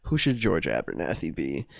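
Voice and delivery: ordinary delivery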